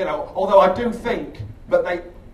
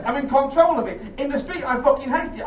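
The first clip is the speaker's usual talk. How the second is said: usual speaking voice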